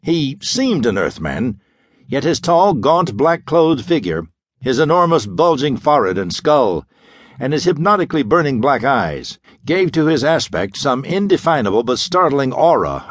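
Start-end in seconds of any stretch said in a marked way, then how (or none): none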